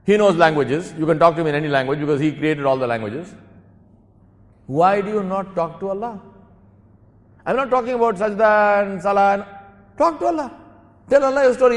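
loud, high-pitched exhortation; impassioned preaching. Real, not acted. Preacher urging listeners to talk to Allah, impassioned.

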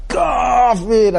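high, drawn-out accusing cry; mock hyped-up shout. Speaker retells a YouTube preacher, mock shout. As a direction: imitate a preacher crying out the word. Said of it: "if you don't say kafir like that".

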